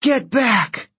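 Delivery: high-pitched, restrained scream. A narrator's reading. Piper orders the surging crowd away, screaming. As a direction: order a mob back with a cry. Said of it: "Piper screamed".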